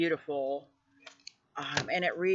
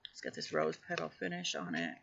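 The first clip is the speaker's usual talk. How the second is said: normal talking voice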